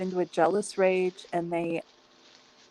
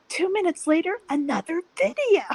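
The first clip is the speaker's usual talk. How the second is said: laughing; 11 semitones higher, 5 dB louder than usual. Real, not acted. Laughing exasperation.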